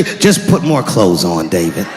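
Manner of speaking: slow, low fatherly voice; deep slow dad brushing off the complaint. Retold or imitated. Voicing his dad.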